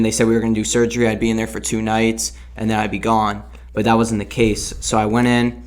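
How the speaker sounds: ordinary delivery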